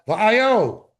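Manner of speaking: va'ayo pushed high and loud; emphatic va'ayo. Shown as a demonstration. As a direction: hit va'ayo like a sharp question. Father's emphatic where.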